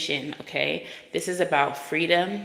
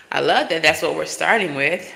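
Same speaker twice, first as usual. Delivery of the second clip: ordinary talk, nothing marked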